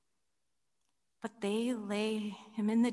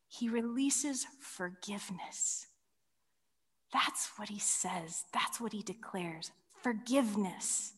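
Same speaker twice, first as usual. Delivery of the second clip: hushed; 5 dB quieter than usual. Real, not acted. Solemn hush after Jesus' words.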